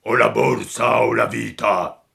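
a lightly voiced cavernous robber demand; cavernous voices. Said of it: "due voci orribili e cavernose".

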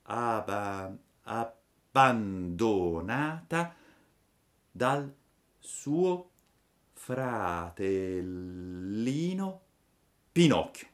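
laboriously spelled out, syllables dragged; very slow, stretched, broken-up reading; halting sounding-out. A narrator's reading. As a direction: read it like a child struggling through each word. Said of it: "quando ebbe compitate alla peggio queste parole".

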